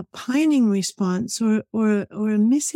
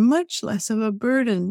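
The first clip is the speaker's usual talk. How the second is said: ordinary talk, nothing marked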